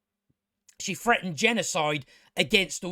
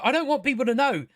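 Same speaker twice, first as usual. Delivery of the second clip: mock Miriel; 6 semitones higher, faster than usual. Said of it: "she's all like".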